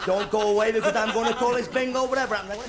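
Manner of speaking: high, frantic zany voice; Jerry Lewis bingo caller. Retold or imitated. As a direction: squeal it like a zany comic. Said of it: "I think he'd do it something like this".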